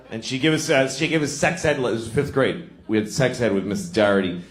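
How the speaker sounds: normal talking voice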